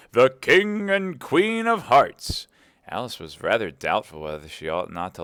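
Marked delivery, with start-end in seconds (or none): 0.1-2.5 high, loud, drawn-out proclamation; grand announcement. A narrator's reading. Narrator announcing the royal procession, grand slow proclamation. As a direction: announce them slowly like a royal herald.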